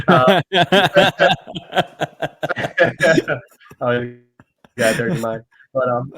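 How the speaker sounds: loud, high bursts of real laughter; laughing at innuendo. Real, not acted. Laughing at his own dirty-minded slip to his friend, loud real laughter. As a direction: crack up at an accidental dirty joke.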